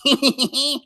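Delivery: high, giggly villain cackle; put-on high evil laugh; Skeletor cackle. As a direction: cackle like a cartoon bad guy. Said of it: "wants them to go".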